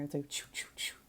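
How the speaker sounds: soft sneaky choo choo noises; whispered ninja footsteps; ninja sound effect. Pretend.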